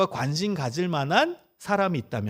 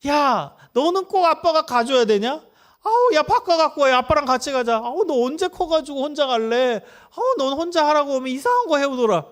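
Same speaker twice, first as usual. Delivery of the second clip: much higher and louder exasperated nagging; dad losing his temper at the ugly glasses; father scolding his son over badly chosen glasses, angry; 9 semitones higher, 4 dB louder than usual. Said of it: "화부터 내잖아요".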